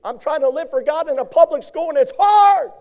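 high loud complaining voice; high loud mock lament; whiny excuse. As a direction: mimic a churchgoer whining. Preacher voices congregant's excuse.

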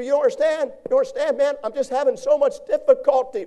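high, loud pleading churchgoer; high-pitched loud plea of a struggling member; whiny complaint. Retold or imitated. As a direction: voice a frustrated believer begging for help. Congregant's complaint voiced by preacher.

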